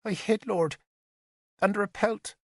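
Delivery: put-on high, small child's voice, reciting; a nine-year-old saying rehearsed words; young girl. Little witness telling the lords her loss, childlike recital.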